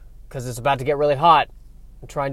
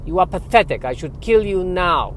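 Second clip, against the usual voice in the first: put-on incredulous echo building to higher scorn, Dracula-style accent; scornful Eastern European vampire accent; 3 semitones higher than usual. Vampire barista disdaining a simple tea order, scornful Eastern European accent. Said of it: "question about the accent".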